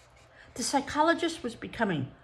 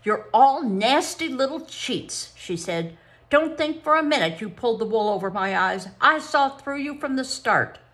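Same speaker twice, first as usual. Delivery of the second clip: angry scolding; 6 semitones higher, 6 dB louder than usual. Acted. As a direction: tell off the cheats. Psychologist scolds the children.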